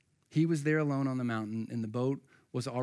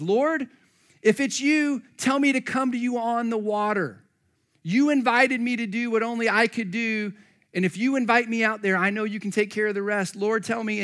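high, louder eager pleading; Peter; 6 semitones higher, 5 dB louder than usual. Acted. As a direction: beg boldly to be called out on the water. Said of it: "look at what Peter says".

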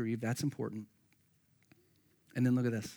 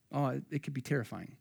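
ordinary talk

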